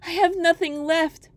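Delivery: put-on young girl's high wail; despairing maiden. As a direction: cry out your hopelessness.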